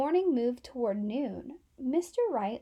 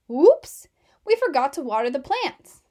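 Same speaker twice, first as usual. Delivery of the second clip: loud sudden exclamation; mock-startled kids, louder; whoops; 9 dB louder than usual. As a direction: blurt it out, startled. Kids' sudden realization.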